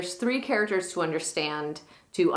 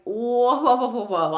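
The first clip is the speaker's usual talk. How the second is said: louder, higher string of whoas; mock alarmed protest; 5 semitones higher, 4 dB louder than usual. Benedick balks at being asked to kill Claudio, mildly alarmed. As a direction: back off in playful alarm. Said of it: "And Benedick is like".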